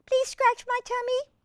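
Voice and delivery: very high squeaky cat voice; tiny high kitty pleading. Acted. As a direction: beg sweetly like a cat. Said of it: "She says".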